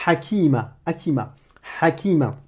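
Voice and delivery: "Hakima" with a raspy, strangled h each time; Arabic ḥ sound. Shown as a demonstration. Teacher repeating "Hakima" with a throaty h. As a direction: rasp the h of "Hakima". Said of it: "ça va nous donner".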